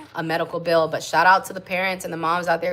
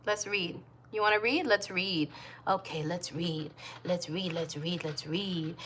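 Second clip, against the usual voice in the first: soft coaxing; 9 dB quieter than usual. Real, not acted. Coaxing the baby to read.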